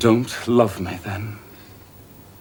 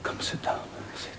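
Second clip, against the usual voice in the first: much softer, hushed begging; pleading whisper; 16 dB quieter than usual. Acted. Rochester begs Jane to trust him, soft pleading whisper. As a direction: coax her gently, barely voiced.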